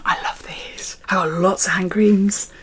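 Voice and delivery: soft unvoiced aside about hand creams; breathy confiding whisper. Real, not acted. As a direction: whisper it like a secret to the viewer. Aside while showing hand cream.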